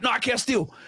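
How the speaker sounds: ordinary talk, nothing marked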